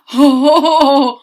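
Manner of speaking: loud high laugh; big happy laugh; hearty laugh. A narrator's reading. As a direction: burst out laughing. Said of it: "He laughed and laughed".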